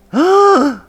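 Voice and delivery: sudden high gasp of alarm; sharp gasp. Real, not acted. Streamer nearly falling off a high crane, sharp gasp. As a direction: gasp as the edge comes too close. Sudden fright at a near fall.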